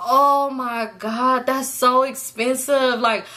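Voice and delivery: very slow, drawn-out mock dismay; whiny driver. Imitating the Uber driver fretting about cost, drawn-out dismay. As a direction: stretch each word like a fretting driver. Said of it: "So he was like".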